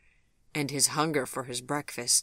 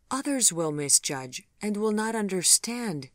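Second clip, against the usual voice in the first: higher, worried voice; 7 semitones higher than usual. A narrator's reading. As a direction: let the worry show. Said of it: "hear the worry in her voice".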